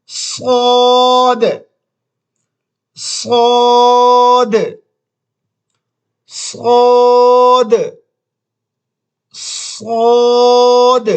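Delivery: صاد said thick and full-mouthed, slow; heavy saad. Shown as a demonstration. Teacher drilling the letter for students, صاد heavy and slow. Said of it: "یہ لفظ پھر موٹا".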